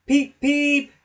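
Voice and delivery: loud, high teasing peeps; cheeky whistle. A narrator's reading. Cheeky Thomas whistling at Gordon. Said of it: "Thomas always whistles".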